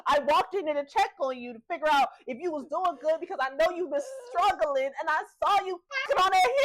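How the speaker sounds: high, loud outraged rant; worked-up scolding. Retold or imitated. Ralph scolding Gemma over the human, outraged. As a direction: scold her, voice climbing.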